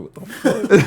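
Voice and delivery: high, laughing what the fuck; shocked. Retold or imitated. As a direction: blurt it out in disbelief. Acting out a shocked customer.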